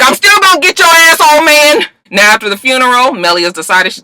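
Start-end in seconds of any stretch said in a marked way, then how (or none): 0.0-1.9 much higher, louder taunt; mock threat. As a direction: promise revenge to the old man. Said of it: "So Liv was just like".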